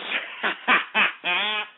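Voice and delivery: a short burst of laughter at his own joke; amused laugh. Real, not acted. Host laughing at his own market rant, amused laugh.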